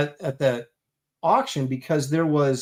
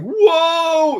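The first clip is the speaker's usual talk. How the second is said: mock whoa; 13 semitones higher, 7 dB louder than usual. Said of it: "everybody was like".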